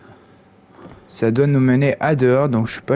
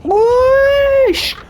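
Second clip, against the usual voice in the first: much higher shriek, then slow dismayed moan; startled scream; 7 semitones higher, 3 dB louder, much slower than usual. Real, not acted. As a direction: scream from a jump scare. Jump scare in horror game.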